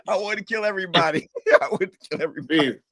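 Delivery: ordinary delivery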